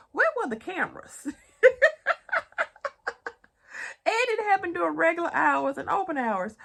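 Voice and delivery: loud, high disbelief with a laugh; can't believe it, laughing loud; real shock and laughter at the heist; incredulous. Real, not acted.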